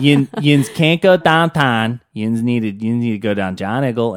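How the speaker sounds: broad Pittsburghese, flattened vowels; Pittsburgh accent. Shown as a demonstration. Teasing partner with local phrases, hammed-up Pittsburgh accent. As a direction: ham up the Pittsburgh local talk. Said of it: "than that accent".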